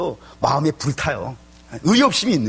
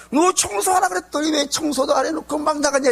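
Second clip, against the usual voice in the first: angry scolding; 11 semitones higher than usual. Retold or imitated. Angry mother scolds child.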